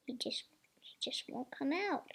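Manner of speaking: soft frustrated whisper. Real, not acted. Stuck toy won't come out, soft whisper. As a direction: whisper it under your breath.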